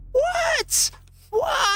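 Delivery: very high squeaky "What?" in play disbelief; mock shock. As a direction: squeak it like you can't believe it.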